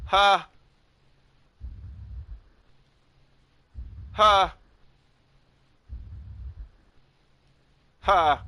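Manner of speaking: loud high confused huh; baffled huh. Real, not acted. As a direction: react in total confusion. Confused by game.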